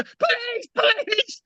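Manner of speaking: high, urgent call of police; imitating officers shouting police; a retold police raid shout; mock police shout. Retelling police breaking in shouting.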